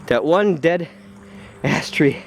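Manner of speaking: ordinary delivery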